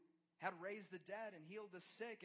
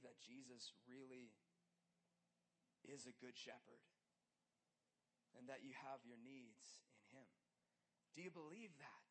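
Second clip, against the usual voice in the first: hushed preaching; 6 semitones lower, 8 dB quieter than usual. Acted. Soft intimate preaching.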